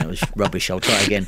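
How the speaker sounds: ordinary talk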